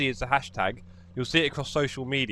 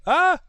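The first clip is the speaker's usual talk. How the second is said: high sharp yelp; sharp sour yelp; 6 dB louder than usual. Real, not acted. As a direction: cry out at the sourness. Reaction to sour candy.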